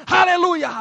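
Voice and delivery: loud joyful hallelujah; shouted hallelujah. Shown as a demonstration. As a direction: shout it out from the spirit. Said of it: "berteriak keluar dari rohmu".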